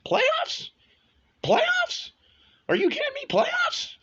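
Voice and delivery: a coach asking in disbelief whether he heard right; Jim Morris rant. Pretend. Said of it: "this might be a Jim Morris situation".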